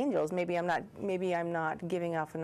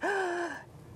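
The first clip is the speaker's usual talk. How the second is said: sharp, high intake of breath; demonstrated gasp of nervous-system arousal; startled gasp; 11 semitones higher than usual. Shown as a demonstration. Therapist showing fight, flight or freeze, startled gasp. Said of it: "I'm like this".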